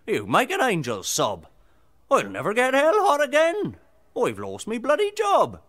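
high, loud, outraged devil tirade; furious devil, yelling in a high voice; riled devil. Acted. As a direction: rant as an indignant devil who's been put out of work. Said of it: "Right riled he were".

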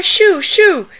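loud, high shoo shoo; shooing yell. Acted. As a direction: shoo the birds away hard. Grandma chasing birds off pie.

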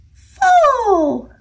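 high squeaky squirrel answer. A narrator's reading. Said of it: "said another squirrel".

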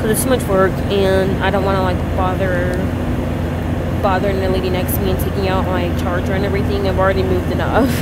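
loud talking over plane noise; too loud, headphones on. Real, not acted. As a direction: speak up over roaring cabin noise. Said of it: "It's so hard to talk when you can't hear yourself".

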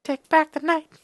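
very high sung-out line; falsetto. Acted. Dramatic game line, falsetto.

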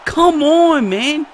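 high-pitched disbelieving complaint; incredulous protest. Real, not acted. Gamer complaining to the game, incredulous protest. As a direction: protest loudly at an unfair blow.

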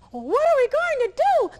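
high, loud panicked hen; alarmed chicken. Acted. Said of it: "the chicken clucked in alarm".

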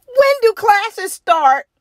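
a little bird impatient to begin; eager penguin. A narrator's reading. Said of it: "said Penguin".

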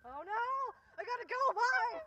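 high loud shouted escape; mock panic. Friend pretending to flee, high loud mock panic. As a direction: yell it while dashing off.

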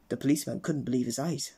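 plain reading voice

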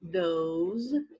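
those, ending in a buzzing Z; those with Z sound. Shown as a demonstration. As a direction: finish those on a Z. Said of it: "com som de Z também no final". (those com som de Z no final)

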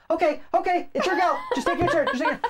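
high laughing hurry-up; high mock-annoyed laughing plea; laughing frustration. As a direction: beg her to move on while laughing. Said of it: "I get so mad".

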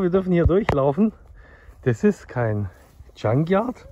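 ordinary delivery

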